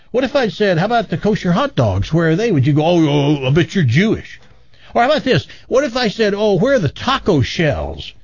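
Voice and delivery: hammed-up offense, building pitch; offended indignation. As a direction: rant like an offended customer. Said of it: "the clerk is offended by that".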